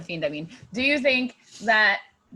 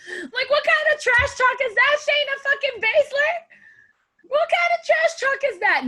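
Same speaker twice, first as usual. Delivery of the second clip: squeaky high laughing outrage, much higher; laughing disbelief; 12 semitones higher than usual. Real, not acted. Laughing at bad trash talk.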